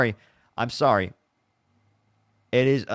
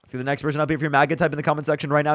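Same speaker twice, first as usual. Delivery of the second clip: announcer voice; 5 semitones higher, 3 dB louder, faster than usual. Acted. Stream host plugging channel.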